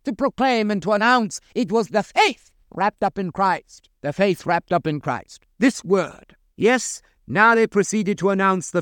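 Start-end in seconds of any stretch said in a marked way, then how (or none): none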